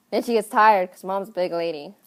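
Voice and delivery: ordinary talk, nothing marked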